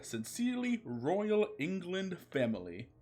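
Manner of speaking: high, snooty letter voice; insulting letter. Acted. The royal family snubbing Garfield by letter, haughty. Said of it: "said the letter with insult".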